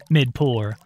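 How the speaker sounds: ordinary delivery, nothing marked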